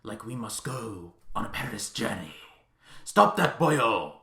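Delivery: put-on muddled Irish-Welsh-Scottish accent, hushed, then shouted at the end; put-on mix of Irish, Welsh and Scottish accents; Robert Downey Junior. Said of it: "he puts on this accent".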